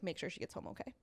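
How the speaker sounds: soft, breathy under-the-breath instruction; quiet aside. Retold or imitated. Friend quietly telling the Uber driver, soft aside. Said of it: "and was like".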